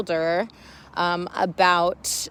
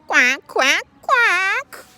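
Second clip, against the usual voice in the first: high loud quacks rising; duck quacking; 13 semitones higher, 6 dB louder, much slower than usual. Acted. Teacher voicing the duck for class, loud high quacks.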